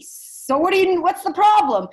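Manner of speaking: high, loud father's retort; acted impatient shout. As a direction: demand an answer impatiently. Father snaps back in story.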